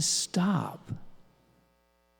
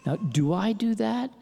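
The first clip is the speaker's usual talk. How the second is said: self-questioning; 4 semitones higher than usual. Retold or imitated.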